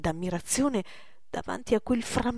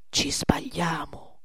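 whispered aside; 4 semitones lower, 3 dB quieter, slower than usual. Acted. Porbus cautious aside.

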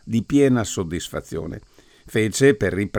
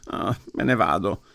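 choked voice. Acted. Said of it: "con voce strozzata".

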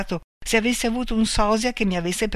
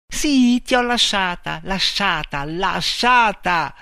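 raised voice, last word drawn out slowly; crying out; 4 semitones higher, 5 dB louder, slower than usual. A narrator's reading. Man tells Marta he left her, crying out emphatically. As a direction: cry it out, hammering each repeat. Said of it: "gridò".

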